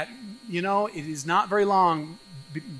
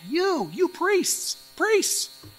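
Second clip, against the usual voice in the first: you priests said slow and much higher; emphatic you; 11 semitones higher, much slower than usual. Shown as a demonstration. Malachi's emphatic Hebrew accusing the priests, stressed. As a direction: lean hard on you priests.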